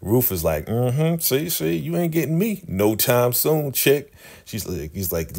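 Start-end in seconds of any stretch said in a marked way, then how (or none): 0.7-4.1 high, sassy gloat; smug high-pitched taunt. Retold or imitated. Ruth gloating at Elder Mother.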